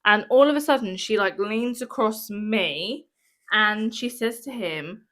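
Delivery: usual speaking voice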